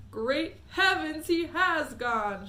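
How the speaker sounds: loud, slow stunned cry; shouted. Acted. Father shouts in shock that Mike vanished. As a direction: let the shock land slowly. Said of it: "shouted Mister T V".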